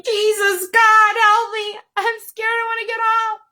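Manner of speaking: high pleading, no real scream; a retold plea to get off, lightly voiced; mild mock panic. Retelling her fear to viewers, high mock-scared plea. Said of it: "I'm screaming".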